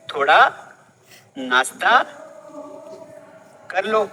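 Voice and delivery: थोड़ा, नाश्ता, कर लो each said apart with pauses and a slight rise; slow separated words. Shown as a demonstration. Teacher showing the offer word by word, spaced out. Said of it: "इसको दो तरीके से बोल सकते हैं".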